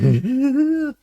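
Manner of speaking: high manic cackle; Junkrat's mad laugh; silly crazy laugh. Retold or imitated. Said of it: "do silly laughs".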